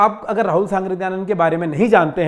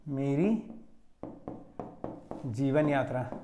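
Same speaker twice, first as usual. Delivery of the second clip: मेरी, a long pause, then जीवन यात्रा, slow and softer; slow dictation; 5 semitones lower, 11 dB quieter, slower than usual. Shown as a demonstration. Teacher dictating the title to students, slow and spaced. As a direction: spell out मेरी ... जीवन यात्रा word by word for notes. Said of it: "आत्मकथा लिखिएगा".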